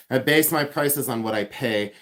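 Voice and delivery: ordinary talk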